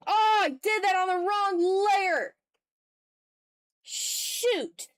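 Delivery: real dismay at a painting slip, pitched high and loud; frustrated surprise. Real, not acted. Streamer realizes she painted on the wrong layer, dismayed outburst.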